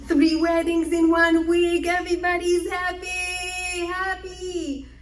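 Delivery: slow, high, ringing joy; joyful celebration. A narrator's reading. Narrator announcing three village weddings, slow high joy. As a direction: sing out the happy news.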